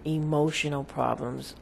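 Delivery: ordinary delivery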